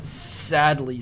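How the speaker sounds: ordinary delivery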